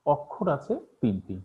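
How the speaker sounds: usual speaking voice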